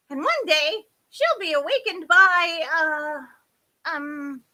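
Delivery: high voice stalling into ums; hesitant fairy. A narrator's reading. Half-deaf fairy groping for a blessing, hesitant. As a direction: start loud and high, fade while searching.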